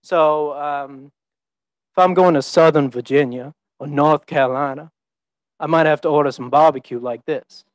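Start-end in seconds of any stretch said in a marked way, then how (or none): 1.9-7.7 smooth Southern drawl with dropped R's. Shown as a demonstration. Speaker showing the audience a Virginia or Carolina barbecue order, Southern drawl. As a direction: draw the vowels out long, Southern style, R's softened to ah. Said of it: "There's the drawl and there's the twang".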